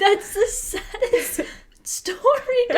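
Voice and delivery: high, squeaky tearful laugh-talk; real teary sympathy, voice giving way; tearing up. Real, not acted. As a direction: choke it out between laughing and crying. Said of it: "You're, like, tearing up".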